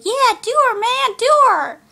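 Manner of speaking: high, loud mock catcall; whooping, hammed-up egging on; imitating rowdy hecklers. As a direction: holler it like jeering moviegoers. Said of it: "whistling and giggling, saying".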